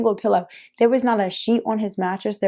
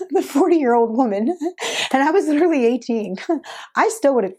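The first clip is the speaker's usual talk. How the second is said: laughing; 6 semitones higher than usual. Real, not acted. Laughing through retelling the fight.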